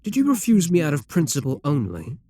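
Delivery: ordinary talk, nothing marked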